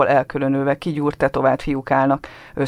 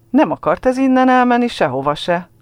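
put-on boy's quick retort; 10 semitones higher, 4 dB louder than usual. A narrator's reading. Ruben blurts quickly.

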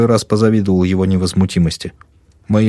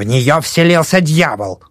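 put-on slow, high old crone declaring; old woman declaring; 8 semitones higher, slower than usual. A narrator's reading. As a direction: proclaim it like a superstitious crone. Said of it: "заявила старуха".

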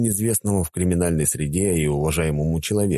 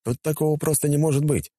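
ordinary delivery, nothing marked

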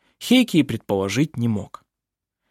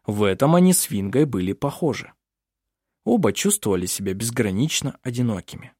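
usual speaking voice

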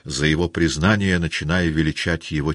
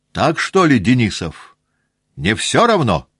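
higher, louder challenge to a comrade; pressing appeal; 8 semitones higher, 7 dB louder than usual. Acted. The staff captain pressing Denisov for support, raised and louder. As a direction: demand agreement from Denisov.

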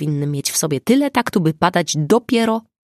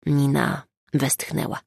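plain reading voice

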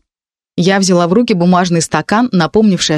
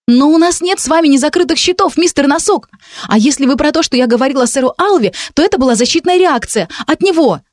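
higher, fast pleading protest; excited protest; 8 semitones higher than usual. Acted. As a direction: protest in alarm to the demon. Heroine protests, jumped up.

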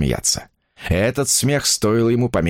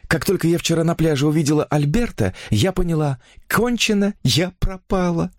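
higher-pitched chattering heroine; infatuated young woman; 8 semitones higher than usual. A narrator's reading. Woman confiding her new romance to a writer, higher enraptured voice.

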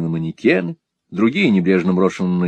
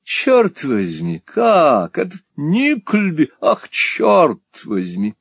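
affected, drawn-out fop voice; camp, simpering, slow exclamations; sassy drag queen; 8 semitones higher, much slower than usual. Acted. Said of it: "жеманно произнёс слова".

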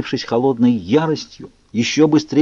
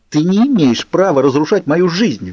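higher, louder furious cry; helpless rage; 7 semitones higher, 4 dB louder than usual. A narrator's reading. Bella rages at Edward for ruining her life, furious cry. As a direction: shake with powerless fury. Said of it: "В бессильной злобе тряслась я".

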